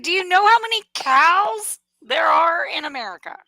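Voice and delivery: high, loud exasperated yell; incredulous shout. Retold or imitated. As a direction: shout it in disbelief. Retold exasperated outburst.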